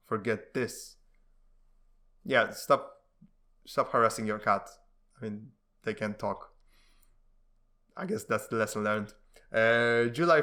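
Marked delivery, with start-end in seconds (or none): none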